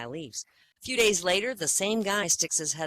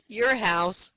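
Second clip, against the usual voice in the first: laughing punchline; 6 semitones higher, 6 dB louder than usual. Acted. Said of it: "Bill says".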